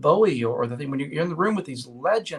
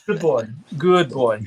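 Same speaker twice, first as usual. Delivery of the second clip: praising dog; 5 dB louder, slower than usual. Real, not acted.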